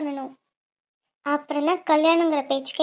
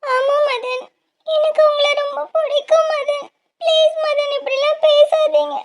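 tearful plea; 8 semitones higher, slower than usual. Acted. Begging lover not to die.

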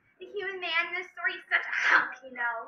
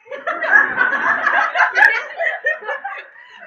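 loud, high laughing fit; burst of laughter; 13 semitones higher, 10 dB louder than usual. Real, not acted. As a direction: crack up loudly, unable to read on. Breaks up after "can't read that.

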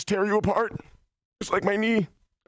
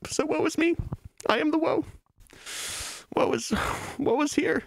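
hammed-up woe; 12 semitones higher than usual. Hammed-up woe bit, pitched up.

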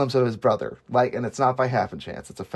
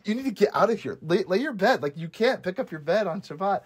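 higher-pitched imitation of fussy objectors; mock scandalized scolding; 7 semitones higher than usual. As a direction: object in a raised, playful pitch. Voicing scolding Pharisees.

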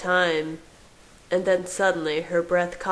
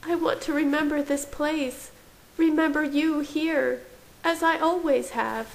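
high-pitched, wistful character voice; yearning woman in a high register; pleading woman; 10 semitones higher than usual. A narrator's reading.

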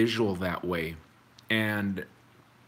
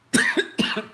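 sudden harsh choking cough; coughing fit; 4 dB louder than usual. Real, not acted. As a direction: cough hard as food goes down wrong. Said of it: "I'm choking".

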